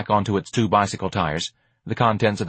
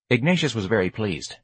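ordinary delivery, nothing marked

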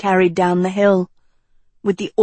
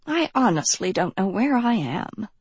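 much softer murmur; 6 dB quieter than usual. A narrator's reading. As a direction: murmur it bewildered. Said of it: "she murmured".

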